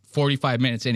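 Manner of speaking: normal talking voice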